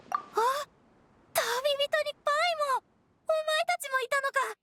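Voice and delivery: high, happy burst of surprise; light up at seeing friends; glad surprise. Acted. Girl spotting familiar faces, delighted high surprise. Collei greets traveler and Paimon.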